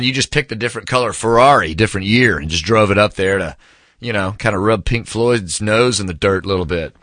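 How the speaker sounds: ordinary talk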